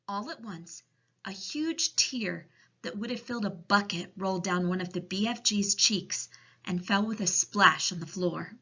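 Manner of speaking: ordinary delivery, nothing marked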